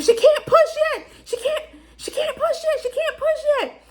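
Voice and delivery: high, far put-on voice, mocking the doctors. Mother mocks the doctors telling her not to push, in a high squeaky voice. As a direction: squeak the doctors' line back at them. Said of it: "the doctors came and was like".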